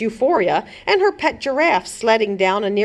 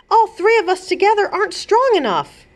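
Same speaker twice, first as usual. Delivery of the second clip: princess voice; 12 semitones higher than usual. A narrator's reading.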